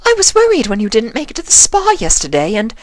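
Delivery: high, elegant lady voice; Rarity's voice. A narrator's reading. Voicing Rarity.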